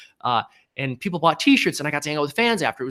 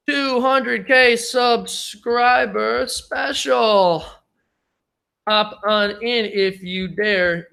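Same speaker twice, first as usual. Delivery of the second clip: dragged-out, lazy hype-man drawl, very slow; slow mock invitation to the viewers; slow put-on voice; 3 semitones higher, much slower than usual. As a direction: stretch every word like a lazy announcer. Slow put-on voice typing post.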